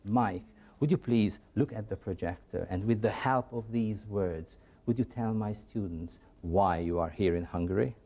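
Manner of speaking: ordinary talk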